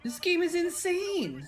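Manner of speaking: very high, slow, drawn-out amazement; astonished exclamation. Real, not acted. Streamer reacting to a wild match, astonished. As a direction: stretch it out high in disbelief.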